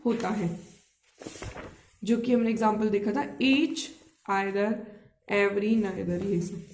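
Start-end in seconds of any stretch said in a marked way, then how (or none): none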